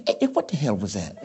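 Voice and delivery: [name] snapping at a wrong note; [name] impression. Actor imitating [name] after a wrong note. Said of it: "He said".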